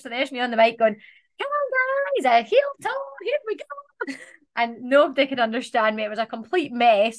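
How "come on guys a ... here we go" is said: put-on thick Scottish accent, pitched much higher. Drunk rep hosting the pool party line dance, in broad Scottish accent. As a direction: hype the crowd in broad Scottish like a tipsy host. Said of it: "Scottish accent, it just was not the same".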